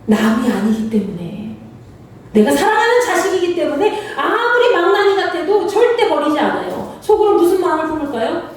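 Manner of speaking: ordinary talk, nothing marked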